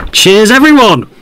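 loud sign-off. Acted. Host signing off the show.

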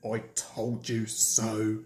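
low, soft, grumbled jibe; rude. A narrator's reading. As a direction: sneer it down low as a sulky engine. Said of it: "said Gordon rudely".

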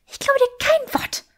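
woman refusing to believe her partner; indignant Granger. Acted. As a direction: fling the disbelief at him. Granger disbelieves him.

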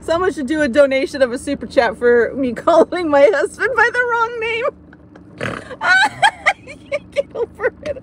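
can barely talk for laughing; laughing hard. Real, not acted. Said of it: "I'm laughing too hard".

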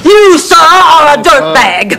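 high, indignant shout; slow it down and shout the insult; indignant outburst. Acted. Insults a man to his face.

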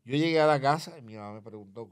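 ordinary delivery

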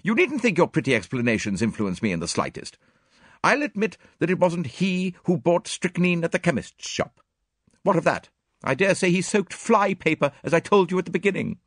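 high-pitched, emphatic retort; brisk, high, indignant rebuttal; sharp. A narrator's reading. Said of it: "she asked sharply".

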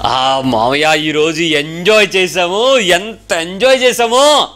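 slow, stretched-out boast rising as it goes; imitated child. Preacher quotes little children telling their uncle, slow childlike gushing. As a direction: mimic a child gushing, drawing out every word. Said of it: "నిన్న మా చిన్నపిల్లలు".

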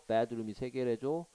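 normal talking voice

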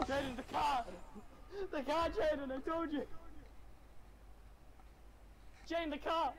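high, loud frantic calling; urgent warning shouts. Real, not acted. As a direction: yell to warn him. Warning friend of cars.

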